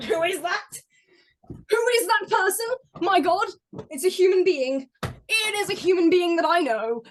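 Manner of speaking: high, excited crowd reaction; hammed-up astonished onlookers; mock amazement. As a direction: marvel loudly at a sudden arrival. Imitating livestream chat's amazement.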